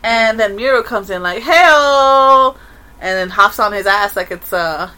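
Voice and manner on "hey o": loud, brash "hey-o"; cocky greeting. Retold or imitated. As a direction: burst in like a show-off. Character Muriel bursts in.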